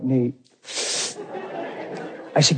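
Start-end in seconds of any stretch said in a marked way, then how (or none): 0.0-0.3 flat, soft "Neat" in reply to the drama; deadpan reply. Retold or imitated. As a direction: answer "Neat" dry and unimpressed. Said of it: "And I'm like".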